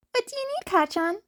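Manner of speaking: put-on a high, friendly boyish question; friendly Izuku. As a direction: ask sweetly. Izuku greets Bakugo in bathroom.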